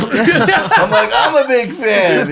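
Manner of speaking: high, giggly fanboy gush; laughing starstruck reply. Retold or imitated. Telling hosts how he answered Samuel L. Jackson, laughing. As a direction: laugh through the words, starstruck.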